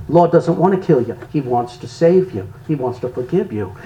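low, softening voice, retold; calm, hushed retold witness; gentle reassurance. Retells gently answering a blasphemer.

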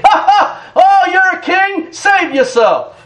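scornful mock with a laugh; mocking soldiers. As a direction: sneer it like a soldier mocking a prisoner. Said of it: "Oh yeah, they're mocking him".

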